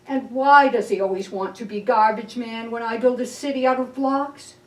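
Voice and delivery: usual speaking voice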